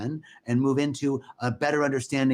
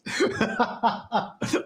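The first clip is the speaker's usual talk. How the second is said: laughing at one's own joke; real laugh; 9 semitones higher than usual. Real, not acted. Speaker cracking up after the Scottish quip, laughing. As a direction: burst of amused laughter.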